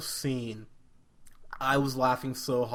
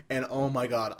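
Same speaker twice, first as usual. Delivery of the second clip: usual speaking voice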